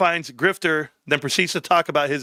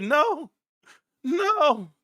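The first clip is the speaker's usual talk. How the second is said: high, pained moan; hammed-up aching groan of a no; mock agony; 11 semitones higher than usual. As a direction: act out being utterly wrecked. Re-enacts aching reply to wife.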